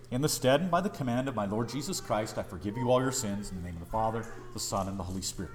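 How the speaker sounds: low, soft, quick recitation; liturgical absolution. Retold or imitated. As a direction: recite the familiar formula evenly and quietly. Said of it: "given to say it in the liturgy".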